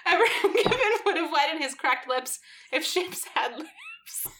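laughter takes over by the end; cracking up. Real, not acted. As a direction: try to read while helplessly laughing. Reader collapses into giggles.

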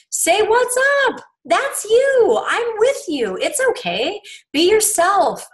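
high, emphatic pep talk; emphatic reassurance. Retold or imitated. Voicing the Savior reassuring a friend.

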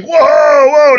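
loud, high-pitched cry of alarm; hammed-up high yell of alarm; alarmed parents. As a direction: imitate parents recoiling in a big drawn-out shout. Imitates other parents recoiling.

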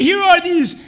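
loud, high, impassioned sermon; full-force preaching. Acted. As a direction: preach it with fire.

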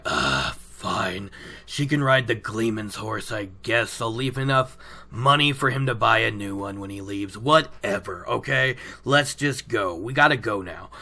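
low, quiet, grumbling acted resignation; grudging surrender. Imitating Lan giving in about the horse, grudging. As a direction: give in with a groan, pitched well down.

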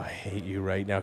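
low, soft aside of mock resentment; retold exasperation. Bartender recalling his dread of superstition talk, exasperated. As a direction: grumble it under your breath.

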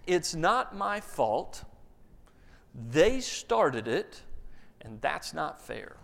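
slow, grave fatherly counsel; solemn wisdom. Acted. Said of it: "And I just tell them".

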